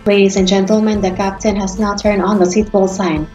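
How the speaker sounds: ordinary talk, nothing marked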